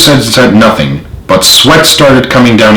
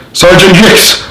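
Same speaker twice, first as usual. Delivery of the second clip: higher, fuming demand; 9 semitones higher than usual. A narrator's reading. General fuming at his sergeant, higher and angry. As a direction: bark the question at the sergeant in fury. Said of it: "he fumed".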